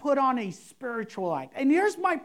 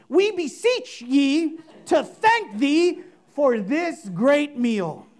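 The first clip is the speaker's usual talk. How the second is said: louder, slow, hammed-up grand prayer voice; pompous mock prayer; 4 semitones higher, 6 dB louder than usual. Pastor parodying a showy spiritual prayer, grandly hammed up. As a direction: overblown old-English prayer, milking every pause. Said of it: "Nobody talks like that".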